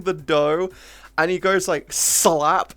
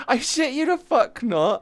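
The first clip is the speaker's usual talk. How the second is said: normal talking voice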